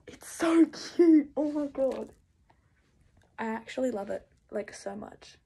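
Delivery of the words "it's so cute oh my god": slow, breathy squeal of delight, louder. Real, not acted. Seeing the mask from Tab, a slow delighted squeal. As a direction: gush over it like you can't contain yourself.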